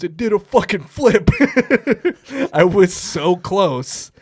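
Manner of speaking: high, loud laughing outburst; amazed, amused exclamation. Retold or imitated. Laughing uncle telling the hunter about the deer, amazed exclamation. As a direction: blurt it out, cracking up.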